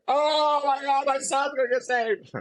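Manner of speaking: very high, excited imitation of mom; high-pitched mock mom, overjoyed; mom's overjoyed cry. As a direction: squeal it like a relieved mother. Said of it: "my mom's just like".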